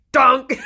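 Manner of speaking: loud, comic "dunk" of a bell; imitated bell clunk. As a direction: blurt out the bell's thud, about to laugh. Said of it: "the bell just goes".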